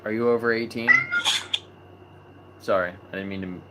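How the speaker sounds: ordinary delivery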